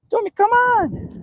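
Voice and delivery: very high-pitched coaxing call; calling the dog. Real, not acted.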